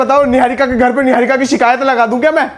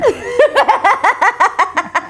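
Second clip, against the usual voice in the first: high cackling laugh; real teasing laughter; 8 semitones higher than usual. Real, not acted. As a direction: burst into a high, rattling laugh. Teasing sibling over the intro.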